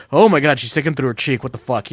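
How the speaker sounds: loud retold gasp of disbelief; loud shocked outburst; shocked yell. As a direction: blurt it out like seeing it first time. Retelling first shock at sideshow.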